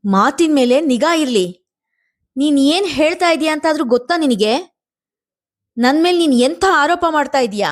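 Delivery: high, forceful, tearful protest; firmly through tears. A narrator's reading. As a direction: speak firmly while crying. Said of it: "ಅಳುವಿನ ನಡುವೆಯೇ ಗಟ್ಟಿಯಾಗಿ ಹೇಳಿದಳು".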